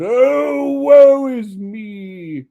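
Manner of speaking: high, loud, very slow moaning wail; ghostly wail. Acted. Ghost lamenting to Scrooge, loud slow wail. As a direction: wail out the woe.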